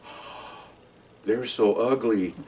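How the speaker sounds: a slow, quiet aside not meant to be overheard; subdued whisper. Retold or imitated. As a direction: whisper the "oh", then say the rest slowly and softly. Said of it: "said that in a subdued voice".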